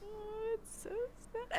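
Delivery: high, quiet fake weeping; soft, high-pitched mock sob; mock crying. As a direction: whimper in exaggerated pity. Mock pity over sick mother.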